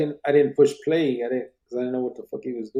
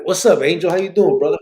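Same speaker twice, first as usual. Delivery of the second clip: loud greeting; 7 semitones higher, 9 dB louder than usual. Real, not acted. Greeting a viewer who joined.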